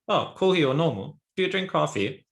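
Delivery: bright, rising, friendly ask of "kohi o nomu"; casual question. Shown as a demonstration. Teacher acting out asking a friend about coffee, casual rising question. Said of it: "Informal, right?".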